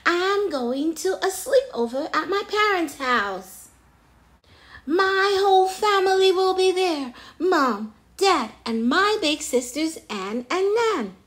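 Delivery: high, loud excited character voice; thrilled storybook chimp; excited announcement. A narrator's reading. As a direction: gush about the plans, loudly. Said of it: "I'm so excited".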